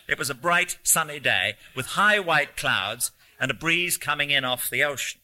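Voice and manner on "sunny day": usual speaking voice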